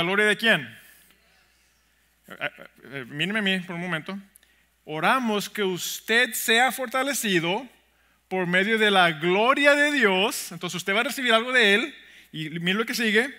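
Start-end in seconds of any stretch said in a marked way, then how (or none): 4.8-10.5 slow, emphatic and raised reading; slow scripture reading. Shown as a demonstration. Pastor rereading the verse for the congregation, slowly and emphatically. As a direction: draw out each phrase of the verse. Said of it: "lo leí más lentamente".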